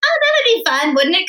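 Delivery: high, breezy and carefree; breezy whim. Retold or imitated. Telling the host about her audition whim, breezy and high. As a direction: toss it off like a lark.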